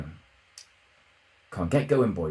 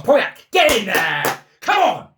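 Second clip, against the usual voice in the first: much higher, much louder roar of joy; goal celebration shout; 12 semitones higher, 9 dB louder than usual. Real, not acted. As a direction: scream the goal home.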